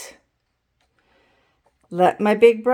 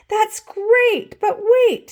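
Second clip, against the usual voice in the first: high, louder thrilled call; excited teacher; 11 semitones higher, 5 dB louder, slower than usual. A narrator's reading. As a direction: stop them with excitement. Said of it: "said Missus Ruler".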